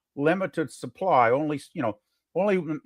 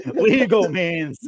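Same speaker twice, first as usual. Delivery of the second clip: slow, louder words through real laughter; laughing; 4 semitones higher, 4 dB louder, slower than usual. Real, not acted. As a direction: repeat it while cracking up, higher and drawn out. Laughs while repeating own remark.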